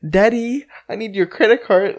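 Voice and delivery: those chicks. Retold or imitated. Friend mocking another by imitating those chicks, mock daddy's-girl voice. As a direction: put on a whiny-sweet rich girl plea. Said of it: "he talks like that? Uh, those chicks".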